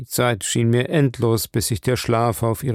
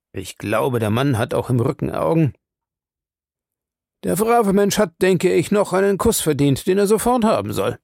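a lightly voiced old doctor, teasing; amused old professor; 5 semitones higher than usual. A narrator's reading. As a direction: tease the young man playfully, higher. Van Helsing jokes about the kiss.

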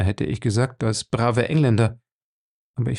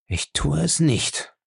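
hoarse; 5 semitones higher, slower than usual. A narrator's reading. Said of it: "sagte er heiser".

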